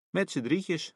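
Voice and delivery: high, joyful calling out; happy call. A narrator's reading. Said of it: "roept mijn vader, net zo blij".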